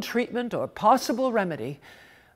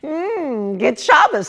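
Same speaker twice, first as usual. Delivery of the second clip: breathless greeting; 6 semitones higher, 4 dB louder than usual. Acted. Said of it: "breathless as usual".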